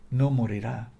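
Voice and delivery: normal talking voice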